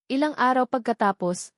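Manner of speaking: usual speaking voice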